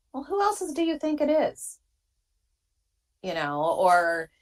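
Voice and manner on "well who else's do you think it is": high-pitched, exasperated comeback; acted-out "duh" reply, pitched way up; mock incredulous retort. Rider answering people who doubt it's the rider's own bike, mock incredulous.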